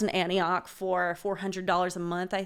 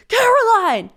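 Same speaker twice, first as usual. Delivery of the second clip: high, loud cry of a name; manic shout; 14 semitones higher, 10 dB louder, slower than usual. Acted. Crazed roommate bursts into her room, manic high shout. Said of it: "this guy just bursts into my room".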